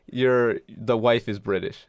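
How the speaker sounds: ordinary talk, nothing marked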